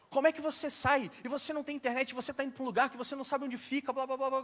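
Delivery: wag a finger at the lost customer; scolding. Retold or imitated. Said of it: "ela começou a me dar mó bronca".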